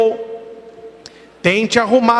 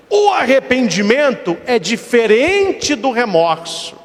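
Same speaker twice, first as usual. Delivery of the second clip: ordinary talk, nothing marked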